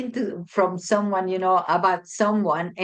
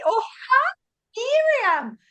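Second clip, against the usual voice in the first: overeager greeting; much slower than usual. Retold or imitated. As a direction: greet her brightly, very high. Greeting the wrong person eagerly.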